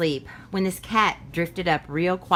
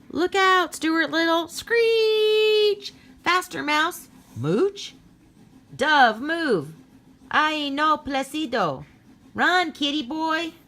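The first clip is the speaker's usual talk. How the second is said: shouting warnings; 11 semitones higher, slower than usual. Acted. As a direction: scream at the cat to run from danger. Everyone shouting warnings at cat.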